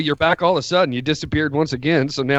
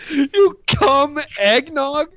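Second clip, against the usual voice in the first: slow, high, laughing disbelief; 10 semitones higher, much slower than usual. Real, not acted. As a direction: gasp it out through laughter. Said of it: "Why are you laughing".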